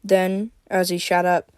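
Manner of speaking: ordinary delivery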